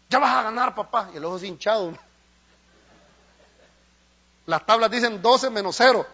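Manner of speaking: loud, urgent preaching with coach's cries; loud pulpit preaching. Acted. Preacher voicing a coach urging his boxer, loud. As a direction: shout it like a corner coach.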